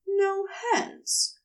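a calm little order, lifted in pitch; Gabriel answering. Acted. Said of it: "Gabriel answered".

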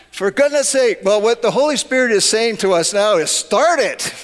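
fervent preaching. Acted. Pastor exhorts congregation.

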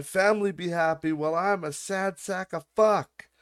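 high-pitched mock complaining; mock screaming rant. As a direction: imitate a self-pitying ranter, pitched high. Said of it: "he's screaming in his car".

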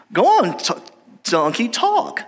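high-pitched coaxing owner impression; donkey's owner. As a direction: act out prodding a donkey to talk. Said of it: "and the owner says".

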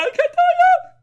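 high loud I can't tell you; excited squeal. Real, not acted. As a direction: burst with glee. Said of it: "I am so excited".

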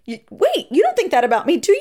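high, loud shocked disbelief; stunned, pitch shooting up; retold jolt of shock, high and loud. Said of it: "I was shocked".

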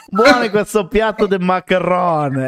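slow mock Italian-American, laughing; hammed-up Italian-American diner; Italian-American accent. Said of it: "sembra di sentir parlare un italo-americano".

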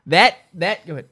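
ordinary talk, nothing marked

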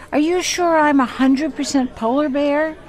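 high, pleading little bear; anxious cub. Retold or imitated. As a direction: fret like a worried cub asking dad. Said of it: "he goes to his father and he says".

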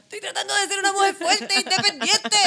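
high, wailing mock sob; mock crying. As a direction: ham up a tearful breakdown. Mock tearful rant.

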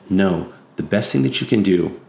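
normal talking voice

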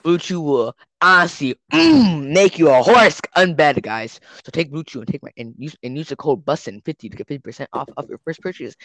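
loud, pushy infomercial pitch; cheesy ad read. Pretend. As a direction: hype it like a late-night commercial. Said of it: "we interrupt this podcast for another ad".

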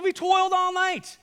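high, loud exasperated protest; emphatic exclamation. Acted. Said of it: "I encourage you to read it with emphasis".

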